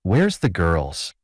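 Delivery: usual speaking voice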